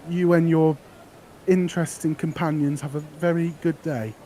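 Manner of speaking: put-on a cheerful girl pushing her flowers; eager flower girl. Flower girl offering her flowers to the adventurer, high and eager.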